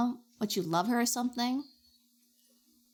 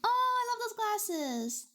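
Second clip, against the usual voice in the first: cooing; 12 semitones higher, much slower than usual. Real, not acted. Cooing over cute glasses.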